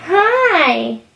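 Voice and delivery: high, drawn-out sweet hello. Real, not acted. Mom greets her waking baby, high baby-talk. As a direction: sing out a high hi to a little one.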